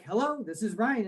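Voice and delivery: usual speaking voice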